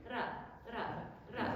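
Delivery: "ra" with one quick tongue tap; single tap r. Shown as a demonstration. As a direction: flick the r once. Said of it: "one tap of the tip".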